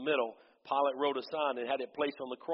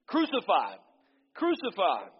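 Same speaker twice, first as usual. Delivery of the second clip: loud, raised crowd chant; frenzied shout; 5 semitones higher, 4 dB louder than usual. Acted. Priests and police crying out for crucifixion, loud chant. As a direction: demand it, over and over. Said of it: "they shouted in a frenzy".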